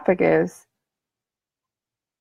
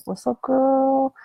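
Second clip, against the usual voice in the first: slow, sly drawn-out greeting; sly self-talk voice greeting you; sly inner voice; 5 semitones higher, 3 dB quieter, much slower than usual. Pretend. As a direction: purr it like a sly friend. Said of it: "she's sly".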